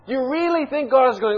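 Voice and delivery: high, loud, heated challenge; defiant preaching. Real, not acted. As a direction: hammer it home to the congregation. Preacher defiantly answers imagined objection.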